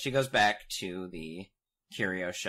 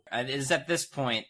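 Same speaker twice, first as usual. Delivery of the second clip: normal talking voice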